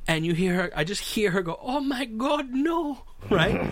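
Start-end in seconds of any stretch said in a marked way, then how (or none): 1.6-3.0 high, mock-horrified little voice; timid dismay. Timid passenger reacting to the snake picture, high mock dismay. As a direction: imitate a timid lady recoiling. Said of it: "very timid looking".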